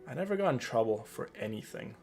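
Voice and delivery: ordinary talk, nothing marked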